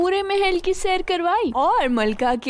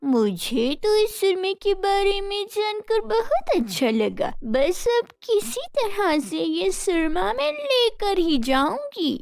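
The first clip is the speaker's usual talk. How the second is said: scheming thought; 5 semitones higher than usual. A narrator's reading. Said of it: "सोचने लगी".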